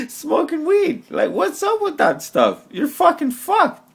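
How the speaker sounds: giddy, laugh-soaked outburst; laughing rant. Real, not acted. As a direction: stretch the words out while cracking up. Laughing rant, much higher, slow.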